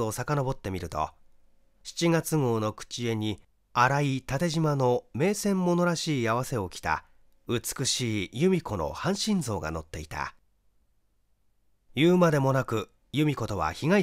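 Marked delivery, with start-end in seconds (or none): none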